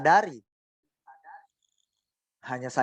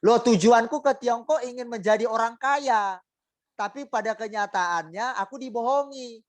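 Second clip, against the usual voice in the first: high whiny sulk, as a grumbling believer; sulking complaint; 7 semitones higher than usual. Acted. A congregant sulking to God about her hardship, whiny. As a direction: pout and whine at God. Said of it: "Coba mereka ngambek".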